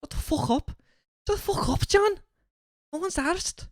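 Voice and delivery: very high, squeezed Scouse retake; aggressive Scouse. Shown as a demonstration. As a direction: have another go at an angry Scouser. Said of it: "in a very aggressive Scouse accent".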